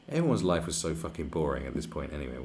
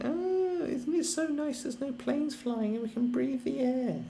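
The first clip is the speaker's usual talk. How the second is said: much higher, prissy mock voice; mocking whiner; slower than usual. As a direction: sneer at the complainers with a pitched-up whine. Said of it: "everyone's gonna be complaining, going".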